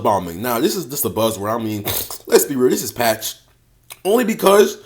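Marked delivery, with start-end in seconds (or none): none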